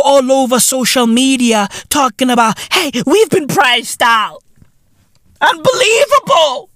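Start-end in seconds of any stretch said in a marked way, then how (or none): 2.7-6.7 high-pitched mock outrage; freaking out. Comedian mimics people on social media, freaking out. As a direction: imitate online panic, voice jumping up.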